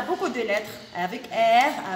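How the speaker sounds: normal talking voice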